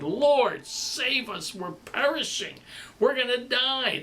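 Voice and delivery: high, frantic cry for help; panicked disciples. Acted. Disciples crying out to Jesus in panic. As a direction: plead like men about to drown.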